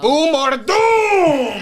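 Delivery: high, drawn-out booming yell; hammed-up roar of doom; booming mock shout. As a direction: blast it out, stretching the vowels. Riffing on being too loud.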